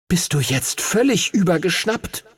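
high, indignant question. A narrator's reading. Dragon to his reckless rider, indignant. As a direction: sound affronted and incredulous. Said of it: "schüttelte empört sein Haupt".

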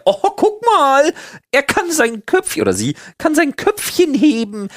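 put-on high, slow baby-talk coo; cooing parent. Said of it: "irgendwann sagst du ja".

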